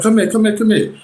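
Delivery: high mock-demanding call; imitating angry summons. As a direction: retell the boyfriend calling you over. Ex yelling, getting in face.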